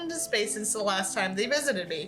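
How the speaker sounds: steady storytelling read; story narration. Acted. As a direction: read the tale to the audience. Reads a horror story aloud.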